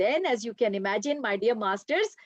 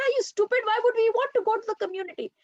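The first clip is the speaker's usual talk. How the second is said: much higher, very fast incredulous outburst; shocked disbelief; 10 semitones higher, much faster than usual. Acted. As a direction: scoff at the idea in alarm. Students reject returning to flock.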